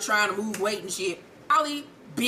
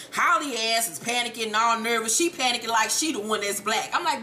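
normal talking voice

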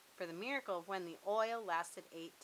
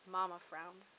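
ordinary delivery, nothing marked

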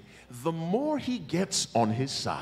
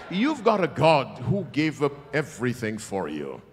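ordinary talk, nothing marked

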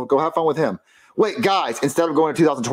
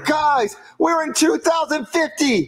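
slow, high-pitched cry; excited announcement; 13 semitones higher, much slower than usual. Acted. Time traveler tells friends they arrived, high drawn-out excitement. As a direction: call it out high, stretching the words.

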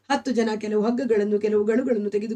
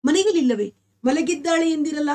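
higher, startled questioning; shocked disbelief; 6 semitones higher than usual. A narrator's reading. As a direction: ask in sudden alarm, pitched up. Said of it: "ನನಗೆ ಮೈ ಜುಮ್ಮೆಂದಿತು". (ಗಾಬರಿಯಿಂದ ಎತ್ತರದ ಧ್ವನಿಯಲ್ಲಿ ಪ್ರಶ್ನೆ)

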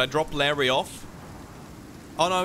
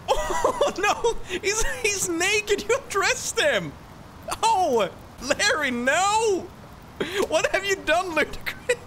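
high, wailing dismay through laughter; playful horror; slower than usual. Pretend. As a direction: wail in hammed-up horror. Mock horror at naked Larry.

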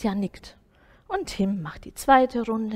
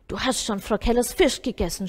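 a touch of scolding, louder; mild scolding; 5 dB louder than usual. A narrator's reading. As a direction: tell off the cat, lightly. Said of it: "schimpft Tim".

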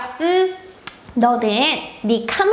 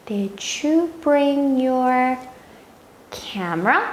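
very slow, clear teacher demo; slow model sentence; much slower than usual. Shown as a demonstration. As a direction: stretch each word out for the kids. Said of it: "요번엔 한번 따라해볼게요". (아주 천천히 또박또박)

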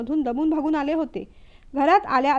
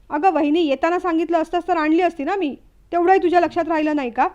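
louder, faster, irritated; angry scolding; 3 semitones higher, 5 dB louder than usual. Acted. Brother-in-law scolds sister-in-law in a loud, quick rant. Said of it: "रागारागाने प्रसाद बोलू लागला".

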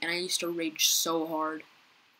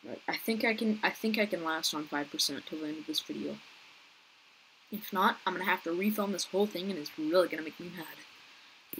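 ordinary talk

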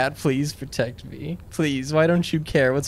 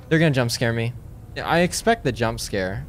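ordinary delivery

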